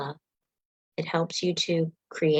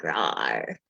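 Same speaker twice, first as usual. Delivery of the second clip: low, playful pretend monster growl; monster rawr. Teacher playing a monster during the jaw stretch, low and playful. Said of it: "be like".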